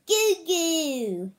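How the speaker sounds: put-on high baby cooing; baby babble. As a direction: coo like a tiny baby.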